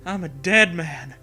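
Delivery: normal talking voice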